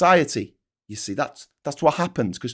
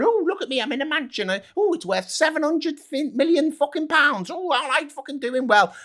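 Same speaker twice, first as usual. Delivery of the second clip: higher, mocking voice of a rich homeowner; mocking rich Tory; 10 semitones higher than usual. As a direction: send up the mansion owner, voice pitched up. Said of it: "sitting there going".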